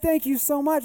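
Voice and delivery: high, loud, gushing thanks; acted-out grateful outburst; retold excited gratitude. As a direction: imitate someone overjoyed and thankful.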